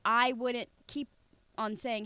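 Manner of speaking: normal talking voice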